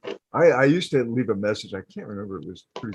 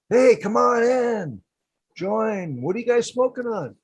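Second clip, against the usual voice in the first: mock streamer; 12 semitones higher, 4 dB louder than usual. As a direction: greet the chat like a hyped streamer. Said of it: "whole live stream like that".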